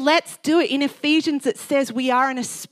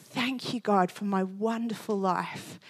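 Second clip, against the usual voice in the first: soft recalled prayer; 2 semitones lower, 8 dB quieter than usual. Retold or imitated. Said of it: "that comes into my head so often".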